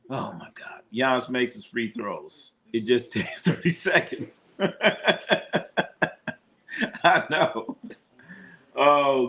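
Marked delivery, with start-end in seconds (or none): 0.1-0.8 whispered. Real, not acted. Reacts to blowout score. 4.6-6.3 breathy laugh. Real, not acted. Laughing at free throw pace.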